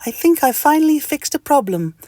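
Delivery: happy, very high squeak; Mister Itch. Acted. Mister Itch answers the mosquito, high and happy. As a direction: squeak it out, pleased. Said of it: "in a happy voice".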